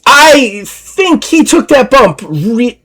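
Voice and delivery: high Scottish lass voice; Deirdre's voice. Acted. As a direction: pitch up as Deirdre. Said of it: "Stands up and goes".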